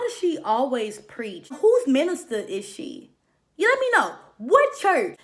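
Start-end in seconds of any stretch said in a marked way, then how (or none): 3.6-5.2 much higher and louder, mock disbelief; incredulous outburst. Real, not acted. Roasting the preachy minister, high and loud disbelief. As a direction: shoot the question up in disbelief.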